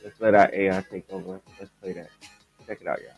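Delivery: normal talking voice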